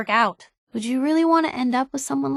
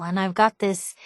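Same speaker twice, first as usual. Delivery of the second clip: normal talking voice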